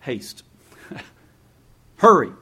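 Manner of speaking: ordinary talk, nothing marked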